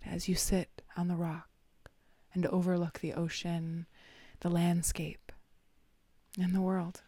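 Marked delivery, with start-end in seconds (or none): none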